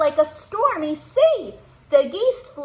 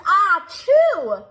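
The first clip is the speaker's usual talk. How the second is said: loud, higher hammed-up sneeze; acted sneeze; 6 semitones higher, 6 dB louder than usual. Bear sneezing in the read-aloud, loud mock sneeze. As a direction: make a big comic sneeze.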